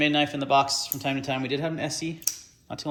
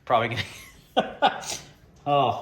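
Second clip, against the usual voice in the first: laughing at himself. Real, not acted. Laughs at own rant.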